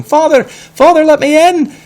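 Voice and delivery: high, loud frantic shout. Acted. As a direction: pound on the door and yell.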